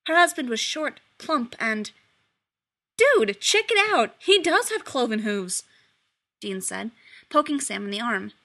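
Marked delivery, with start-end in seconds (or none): 3.0-5.6 high, loud and thrilled; a buddy pointing something out, giddy; gleeful nudge; excited. Acted. Dean excited to Sam.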